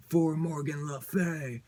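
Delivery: slow, solemn, other-voiced battle cry; a spellbound knight swearing loyalty; enthralled warrior cry. Mind-controlled brother declares his allegiance, slow and solemn.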